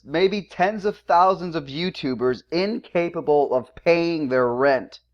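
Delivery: usual speaking voice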